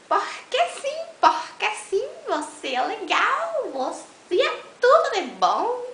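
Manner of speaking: high, loud overdone affection; mock gushing. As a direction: ham up the sweet talk, squealing. Declaração exagerada de carinho. (declaração de carinho exagerada e aguda)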